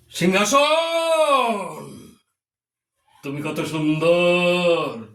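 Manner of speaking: slow, drawn-out jatra declamation; hammy stage actor. Acted. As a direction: proclaim it grandly, stretching every word. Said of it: "Alivardi বলছে". (যাত্রার নাটকীয় সংলাপ)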